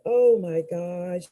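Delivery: ordinary talk, nothing marked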